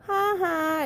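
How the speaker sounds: high, loud mocking ha ha; gloating chuckle. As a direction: laugh at the sinking chipmunks. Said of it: "At Donald's chuckle".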